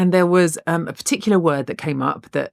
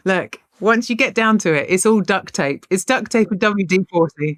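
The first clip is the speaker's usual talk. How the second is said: normal talking voice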